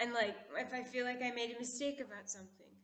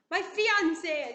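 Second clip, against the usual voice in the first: loud, higher mock-angry snap; angry outburst; 6 semitones higher, 11 dB louder than usual. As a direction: play the jealous fiance exploding. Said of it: "he gets really, really angry".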